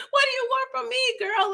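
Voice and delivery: high hammed-up mock [name] plea; [name] mode. Vlogger hamming up [name] pleading with a girl, high mock whine. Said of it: "[name] mode".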